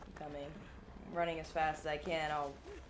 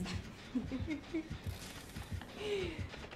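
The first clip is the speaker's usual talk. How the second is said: high laugh; 10 semitones higher, 5 dB quieter than usual. Real, not acted. Laughing at teammate.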